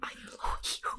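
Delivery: breathy whisper. Pretend. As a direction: whisper it close. Whispered aside.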